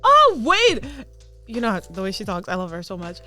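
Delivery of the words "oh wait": high, loud sudden oh wait; sudden realization. Retold or imitated. Idol recognizing the fan's videos, high and startled. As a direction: catch on all at once. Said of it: "And she was like".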